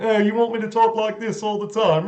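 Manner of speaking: hint of a British comedy character. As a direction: do the comic character Fox complaining. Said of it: "playing that character, Fox".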